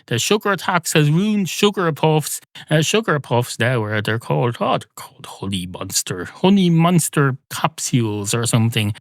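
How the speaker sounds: ordinary delivery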